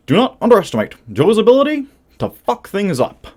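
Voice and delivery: Barack Obama. Retold or imitated. Quoting an apocryphal warning about Joe, mock presidential impression. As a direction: do a hammed-up former president. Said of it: "apocryphal quote from Barack Hussein Obama".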